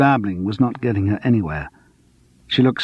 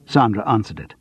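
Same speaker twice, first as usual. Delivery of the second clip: ordinary delivery, nothing marked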